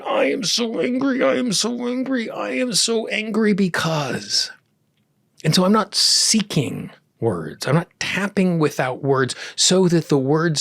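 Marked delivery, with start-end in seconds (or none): 0.0-4.5 high-pitched mock rant, repeated insistently; acted anger. Tapper acting out words suddenly coming up, high mock-angry outburst. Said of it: "this can particularly happen when I'm angry".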